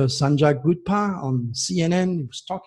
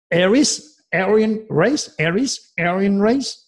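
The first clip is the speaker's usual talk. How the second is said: "Aries" and "Aryan race" pitched higher and louder, each rising; pointed intonation; 5 semitones higher, 3 dB louder, slower than usual. Shown as a demonstration. Astrologer making the host hear "Aries" echo "Aryan race", a pointed rising intonation. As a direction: lean hard on "Aries" then "Aryan race", slow with a rising tune. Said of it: "You see the intonation?".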